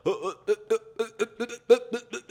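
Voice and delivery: high, loud hammed-up stammer of uh; nervous stuttering. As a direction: freeze up and stammer like a nervous guy. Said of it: "you're fucking stuttering".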